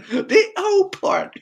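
high, drawn-out replay of a shouted comeback; mock shout. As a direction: relive the shout without full volume. Repeating his shouted comeback, amused.

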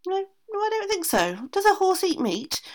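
a high-pitched stubborn horse saying no. A narrator's reading. Horse refusing the aunt, high stubborn voice.

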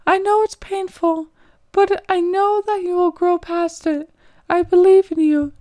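encouraging mentor. Acted. Nguyen encourages crying narrator.

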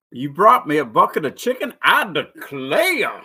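Colonel Sanders. Shown as a demonstration. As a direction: exclaim like a theatrical Southern gentleman. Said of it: "uh, Colonel Sanders".

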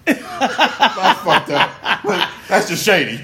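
loud, slow, drawn-out disbelief; outraged. Real, not acted. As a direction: stretch it out in shock. Reacts to shady prank.

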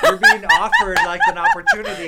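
laughing hard while talking; words squeezed out through laughter. Real, not acted. Laughing through his improv explanation.

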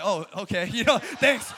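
laughing through a mock thank-you; laughing, sheepish reply to a friend; awkward laughing thanks. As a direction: chuckle out an awkward okay, thanks. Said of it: "I'm like".

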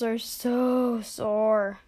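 very slow, achy groan; weary, stretched-out moan. A narrator's reading. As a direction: drag out the words as if aching. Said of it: "slipped into one and groaned".

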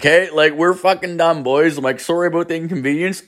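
high, breezy retold farewell; mock-cheerful sign-off. Retold mock-polite exit line to group.

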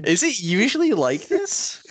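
slow, high-pitched puzzled question; mock-naive passenger. Passenger asking about the missing engine, mock naive. Joins the mock airplane emergency.

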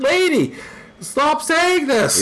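high, slow pleading; exasperated plea. Retold or imitated. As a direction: beg her to stop, drawn out. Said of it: "I'm like".

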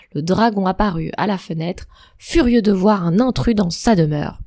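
ordinary delivery, nothing marked

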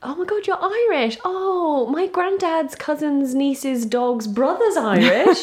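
high, gushing mock delight; over-excited claimant. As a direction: parody someone thrilled to claim Irish roots. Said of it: "the classic thing that Irish people always say".

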